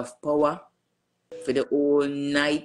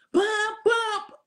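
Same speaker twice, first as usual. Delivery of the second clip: phone alert imitation.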